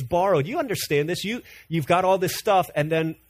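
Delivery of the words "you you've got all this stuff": ordinary talk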